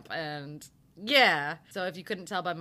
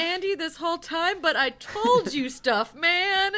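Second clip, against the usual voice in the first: high, louder wounded whine; puppy-like hurt; 10 semitones higher, 7 dB louder than usual. Retold or imitated. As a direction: plead like a kicked puppy. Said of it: "he gets all puppy-like".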